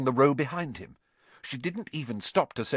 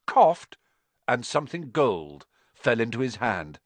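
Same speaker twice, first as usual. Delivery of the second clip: ordinary delivery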